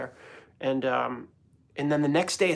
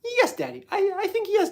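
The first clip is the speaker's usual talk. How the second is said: put-on much higher little-kid voice; son's happy sing-song; imitating toddler; 4 dB louder than usual. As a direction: chirp it like a sweet toddler. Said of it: "there's a time of day where he's like".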